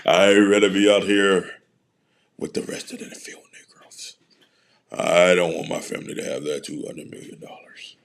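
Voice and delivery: sly hushed whisper of a stubborn player; mock whisper. Mocking a player who won't cut his hair, in a hushed whisper. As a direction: breathe it like a secret joke.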